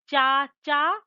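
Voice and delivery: "चाचा" loud, in two long syllables; pronunciation demo. Shown as a demonstration. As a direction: stretch both syllables of "चाचा" for learners. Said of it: "उच्चारण का अंतर दिखाया है".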